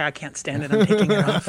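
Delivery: ordinary delivery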